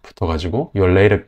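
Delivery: ordinary talk, nothing marked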